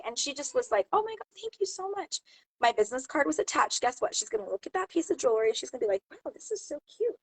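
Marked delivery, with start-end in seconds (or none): 0.9-2.2 gushing thanks. Retold or imitated. Shopper thanking the seller for a gift, imitated high and gushing. 6.1-7.2 high, soft imitation of delight; admiring coo.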